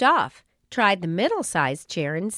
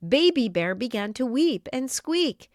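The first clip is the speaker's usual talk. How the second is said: plain reading voice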